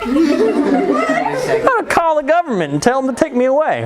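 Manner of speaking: high, whiny threat; hammed-up child's tantrum; mock mad. Mad kid threatens his mom with the government, mock tantrum. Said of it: "I was mad".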